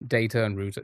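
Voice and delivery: data as "day-ta", router as "rooter"; own pronunciation. Shown as a demonstration. Streamer showing how I say it, data "day-ta", router "rooter". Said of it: "that's how I say it".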